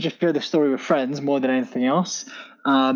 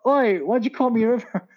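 higher-pitched playful "oi" call-out; mock challenge; 8 semitones higher than usual. Guest pretending to accost his old nanny in the street, mock challenge. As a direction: hail someone in mock demand, pitched up.

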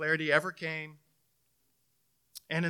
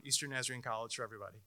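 softer, fast throwaway plug; quick joking aside; 5 semitones lower, 10 dB quieter, much faster than usual. Real, not acted. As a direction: toss it off as a wink.

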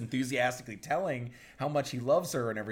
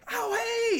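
high, bright burst of delight; excited greeting; 4 dB louder than usual. Retold or imitated. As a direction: greet her, thrilled to see her. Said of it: "he's so excited".

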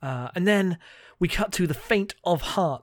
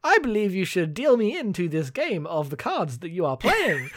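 hammed-up hustler with high, swooping pitch; Claudio faking drunk; 6 semitones higher than usual. Co-host mocking Claudio's clumsy poker hustle, hammed-up and singsong. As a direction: overact a stilted, singsong request to join. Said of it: "Claudio basically goes".

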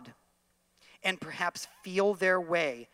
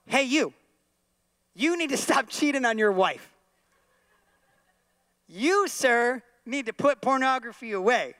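louder and higher, confronting; calling out a stranger; 5 semitones higher, 6 dB louder than usual. Pretend. Preacher confronts a shopper in Wegmans, loud and accusing. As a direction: call him out across the store. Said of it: "and I go".

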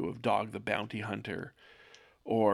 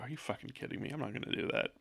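a quick breathy aside under the breath; muttered aside; 8 dB quieter, much faster than usual. Pretend. The speaker turning away from the audience, grumbling under his breath. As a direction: duck away and grumble it half-voiced. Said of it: "turn back around and go".

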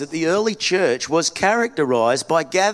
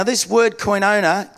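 normal talking voice

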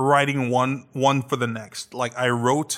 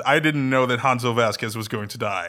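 normal talking voice

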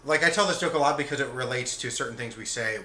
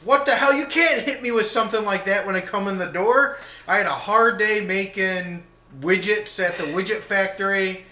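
higher, louder mock outburst at his wife; exasperated husband; 6 semitones higher, 6 dB louder than usual. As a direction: rant like a fed-up fifties husband. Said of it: "And he's like".

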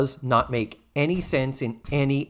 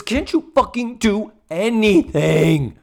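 slow, high, louder exasperated plea; 6 semitones higher, 6 dB louder, slower than usual. Retold or imitated. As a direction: drag out each word, fed up. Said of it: "the implication is".

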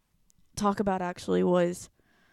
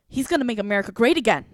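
louder and quicker, a mocking huffy chant of the slogan; huffy retort; 3 semitones higher, 9 dB louder, faster than usual. Imitating the huffy classmate who repeats a slogan. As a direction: toss off the slogan like a huffy student. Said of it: "she gets huffy with me".

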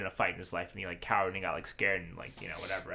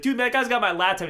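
high, louder pleading voice of the homeless man; 11 semitones higher, 7 dB louder than usual. Retold or imitated. Homeless man pleading with the narrator over the laptop. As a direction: plead your case, voice jumping up. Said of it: "pleading with me to understand".

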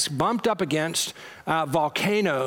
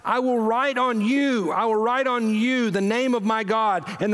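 raised, ringing sermon voice, slowing at the end; fervent scripture reading; 5 semitones higher than usual. Acted. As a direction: proclaim the promise to the church. Reads scripture with rising fervour.

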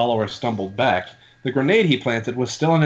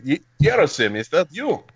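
Vladimir voice; 6 semitones higher than usual. Retold or imitated. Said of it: "he said".

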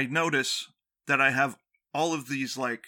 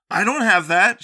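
put-on angry girlfriend; 7 semitones higher, 10 dB louder than usual. Girlfriend denying she has any rash, angry. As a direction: imitate an indignant girlfriend, louder and higher. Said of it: "to express the anger that she had".

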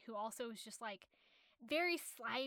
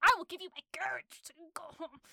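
insistent master; 6 semitones higher, 9 dB louder, faster than usual. Retold or imitated. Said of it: "he's like".

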